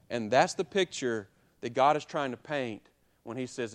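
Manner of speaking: ordinary talk, nothing marked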